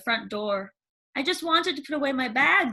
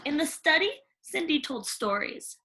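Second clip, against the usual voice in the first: ordinary delivery, nothing marked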